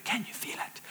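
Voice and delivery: hushed, breathy whisper; savouring whisper. Acted. Mentor's trick for savouring wins, whispered. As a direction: whisper it as if sharing a secret. Savouring a moment, fingers rubbed.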